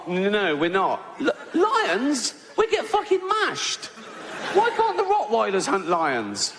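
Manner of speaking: high-pitched squeal of disbelief; panicked protest. Acted. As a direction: protest like a horrified dog given a deadly job. Ridgeback horrified at hunting lions.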